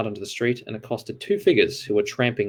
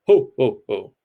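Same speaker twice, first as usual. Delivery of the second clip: Santa laugh; 2 semitones higher, 5 dB louder than usual. Acted. Death repeating the Santa laugh.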